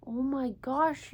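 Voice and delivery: ordinary delivery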